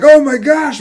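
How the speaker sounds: loud, high shocked exclamation; retold alarm. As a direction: cry out as you replay the shock. Acting out reaction to bleeding chin.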